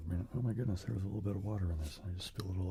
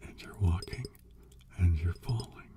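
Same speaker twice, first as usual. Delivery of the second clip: slow whispered trance chant; dreamy hypnotist whisper; hypnotic whisper; 5 dB louder than usual. Acted. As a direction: lull them with a rocking whisper.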